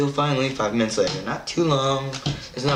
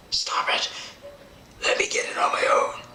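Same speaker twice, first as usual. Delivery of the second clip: unvoiced, hissed mock plea; strained whisper of a helpless patient; whispered protest. Patient begging to wipe their own face, whispered protest.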